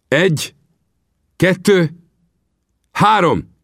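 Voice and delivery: Vinetú counting out the duel, high and measured; solemn count. Acted. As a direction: count slowly, each number its own beat.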